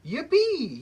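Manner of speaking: high, loud gleeful yippee; excited cry. Acted. Giant getting a birthday card, loud gleeful yippee. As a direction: squeal it with birthday joy. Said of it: "he cried".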